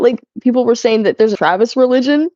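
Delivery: usual speaking voice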